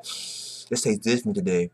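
soft, low wine-sipping purr; hushed, smug villain relishing the taste; savoring villain. Retold or imitated. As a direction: savor every word like a fine wine. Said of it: "He was sipping that wine like".

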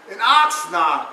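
usual speaking voice

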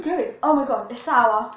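talking while eating candy; mouth full, chewing. Real, not acted. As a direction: hand partly covering mouth. Seen 4: Both just ate candy; left girl's hand at mouth from 1.5.

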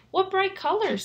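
high, loud delighted sun. Acted. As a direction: gasp at the bright colors. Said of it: "said the sun".

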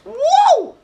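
very high, loud celebratory cry; real excited gamer's whoop; triumphant whoop. Real, not acted. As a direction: whoop as you get away. Triumphant escape in game.

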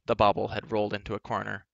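plain reading voice

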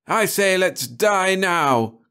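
high, slow, despairing cry. A narrator's reading. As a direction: cry out that all is lost. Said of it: "Cahill cried".

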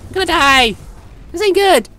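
high loud panicked yells; scared giddy laughing. Real, not acted. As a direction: shriek as the bot goes down. About to die in game.

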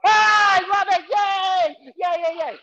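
high, loud delighted yay; ah I love it, yay, over the crowd's German; thrilled cheering. Real, not acted. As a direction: squeal with delight.

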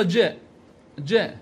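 "ج" as a short "je"; letter sound only. Shown as a demonstration. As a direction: sound "ج" as "je", without the m of jim. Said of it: "ألفظه".

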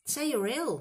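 1 urgent. A narrator's reading. Ron urging.

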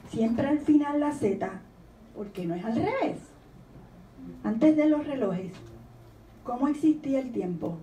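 reading a poem with rising wonder. Acted. Author reading the poem's questions to the audience with wonder. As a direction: ask each question with childlike wonder. Reads the poem aloud, lively.

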